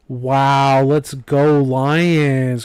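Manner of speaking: slow, drawn-out mock cheer; half-hearted fan cheer for the Lions. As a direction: stretch it like a sarcastic chant. Mock cheer after winning.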